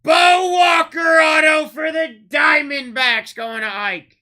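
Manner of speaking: booming, slow, drawn-out call of the card name; hype announcer shout. Real, not acted. Breaker hyping a big pull to chat, booming drawn-out shout. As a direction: belt it out like a ring announcer. Auto pulled on livestream.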